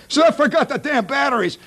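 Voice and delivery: high-pitched frustrated holler; comic exasperated yell. Acted. Stranded husband discovering the missing batteries, high exasperated yell. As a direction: cry it out like a hapless husband.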